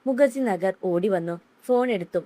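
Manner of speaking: ordinary delivery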